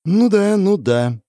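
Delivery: sceptical mock agreement; Russian: сквозь зубы; grudging, through teeth. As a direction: say it grudgingly, bitten off. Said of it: "процедила фиалка".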